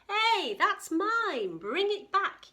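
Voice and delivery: high, loud angry yell. Acted. Katie yelling at pterosaur that stole her scarf. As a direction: shout after the thief. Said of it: "yelled Katie".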